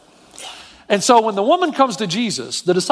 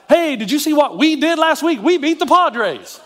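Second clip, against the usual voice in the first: bragging fan; 8 semitones higher than usual. Retold or imitated. Bandwagon Dodger fan bragging.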